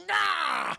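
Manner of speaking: high loud grunt of a throw; effort yell. Retold or imitated. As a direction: heave the tire iron with a yell. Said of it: "He goes".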